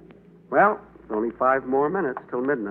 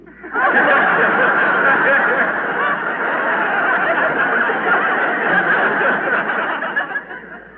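laugh; 4 dB louder than usual. Acted. Long laugh after joke.

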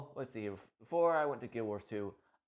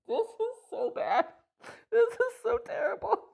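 high, loud, laughing squeal of disbelief; laughing helplessly; 8 dB louder than usual. Real, not acted. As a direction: laugh through the words at how bad it is. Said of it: "I'm laughing".

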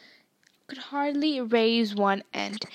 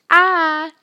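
loud squirm of protest; a boy yelping as his mother wipes his nose; 4 semitones higher, 12 dB louder than usual. Acted. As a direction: yelp as your nose gets scrubbed. Ron's nose rubbed.

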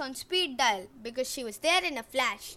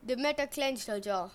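usual speaking voice

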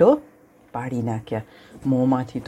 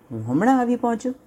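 eager lion; 8 semitones higher, faster than usual. Acted.

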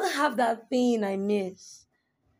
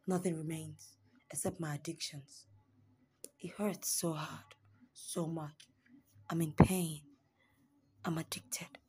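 much softer, lower whisper, fading out; broken whisper; 5 semitones lower, 9 dB quieter than usual. Acted. Poet admitting addiction at the end, whispered. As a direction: confess the last lines under your breath.

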